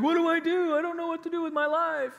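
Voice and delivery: high, loud mock-panicked whine. Preacher mocking people fretting about darkness, high mock panic. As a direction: parody a panicking neighbour. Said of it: "everybody's freaking out".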